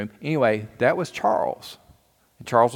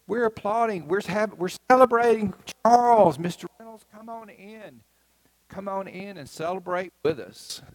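much higher, bright welcoming voice; cheerful teacher; 10 semitones higher than usual. Retold or imitated. Teacher inviting Mister Reynolds in, high cheerful voice. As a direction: sing out the invitation like a delighted teacher. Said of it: "And our teacher said".

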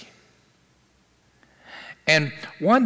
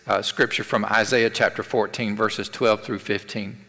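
normal talking voice

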